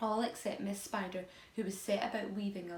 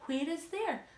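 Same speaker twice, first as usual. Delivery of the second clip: puzzled question; 8 semitones higher, 3 dB louder, slower than usual. A narrator's reading. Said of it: "they asked".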